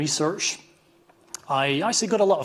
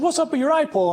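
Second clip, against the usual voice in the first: high, loud, put-on San Diego accent; hammed-up San Diego accent, pitched way up; imitating a San Diego accent, by his own account badly; 6 dB louder than usual. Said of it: "a San Diego accent".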